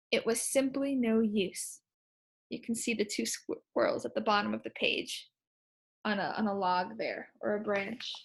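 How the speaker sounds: quiet aside. Real, not acted. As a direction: drop the voice for an aside.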